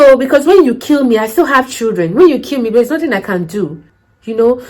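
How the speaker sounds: normal talking voice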